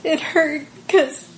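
emotional. Real, not acted. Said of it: "Even now I'm getting a little emotional".

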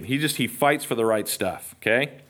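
normal talking voice

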